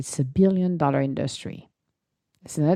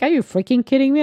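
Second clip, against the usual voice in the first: mock outrage; 8 semitones higher, 7 dB louder, faster than usual. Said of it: "I'm like".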